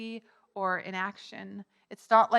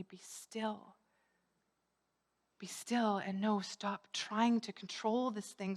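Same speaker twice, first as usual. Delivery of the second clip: hushed, much softer voice; soft, calming; 11 dB quieter, slower than usual. Acted. The Lord to a panicking believer, soft calming. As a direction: quietly still an anxious listener.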